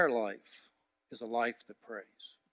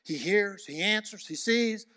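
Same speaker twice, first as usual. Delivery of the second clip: ordinary talk, nothing marked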